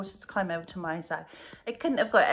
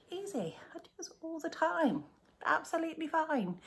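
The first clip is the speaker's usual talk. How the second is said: high, breathy little voice talking herself up; mock self-reassurance; 9 semitones higher than usual. Reversing into a tight parking space, she tells herself it's easy in a high whisper. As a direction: whisper a confident pep talk to yourself. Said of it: "and I thought".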